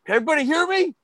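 high, projected question; calling to a room. Retold or imitated. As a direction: call out to the back of the hall. Caller addressing the whole hall.

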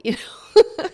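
laughing high and loud; giggling at her own drama. Real, not acted. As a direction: laugh through the words.

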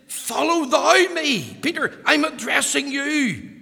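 loud, high, driving sermon voice; preaching at full force. Acted. Preacher urging Peter to follow Christ, full-force preaching. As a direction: preach it hard, pressing the point home.

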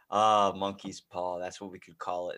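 usual speaking voice

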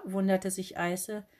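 plain reading voice

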